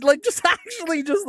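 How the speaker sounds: words interrupted by an unforced laugh; real laughter. Real, not acted. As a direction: protest while genuinely cracking up. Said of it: "I'm not playing it up either".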